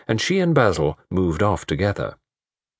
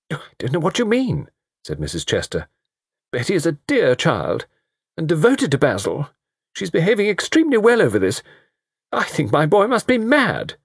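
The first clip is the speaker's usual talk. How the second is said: high indignant mother; 9 semitones higher than usual. A narrator's reading.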